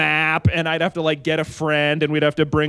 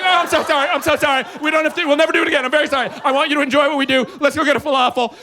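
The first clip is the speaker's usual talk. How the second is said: high, fast, panicked apologizing; frantic apology; 8 semitones higher, faster than usual. Retold or imitated. Boyfriend panicking to his girlfriend, high rushed apology. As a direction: gush out sorry in a rushed panic. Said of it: "And I was like".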